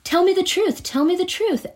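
high, pushy repeated demand; imitating a nagging husband; insistent hammering. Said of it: "he just kept hammering at".

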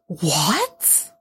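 a stunned, rising what; real disbelief at the twist; shocked what. Real, not acted. Host hears a contestant's reveal, then reacts in shock. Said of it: "I'm actually shocked".

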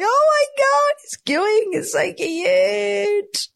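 very high, slow, drawn-out squeal of delight. Retold or imitated. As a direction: coo with delight at the gooing baby. Delighted at baby's gooing.

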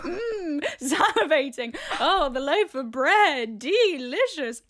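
high, loud, drawn-out mock swoon; exaggerated foodie rapture; hammed-up craving. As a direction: savour each word as if drooling, slowly. Acts out drooling over the bread.

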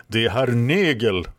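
mild outburst of recognition; exclamation. A narrator's reading. Red Lady spots the enemy knight, mild exclamation. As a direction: exclaim it, lifting the name. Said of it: "utbrast Röda Damen".

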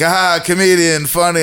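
high, dismissive boss voice; mock sarcastic boss. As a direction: brush off the quitter as a joker. Boss mocking the quitter.

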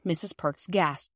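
plain reading voice

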